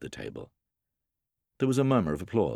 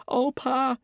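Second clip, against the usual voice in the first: put-on high-pitched little boy's cry; scared child pleading; frightened cry; 4 dB louder than usual. A narrator's reading. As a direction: cry out to Pa in fear. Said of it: "The little boy cried".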